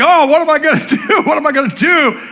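high, loud panicky cry; mock panic, hands in the air; panicked believer. Believer in a faith crisis, panicking loudly. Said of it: "we're like".

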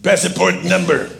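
slow imitation of a Spanish-accented soldier; soldier's accent. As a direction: demand it slowly in a mock Spanish accent. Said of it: "He goes".

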